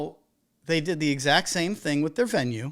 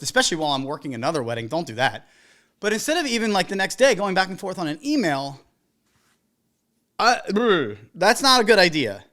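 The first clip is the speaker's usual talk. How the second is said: ordinary talk, nothing marked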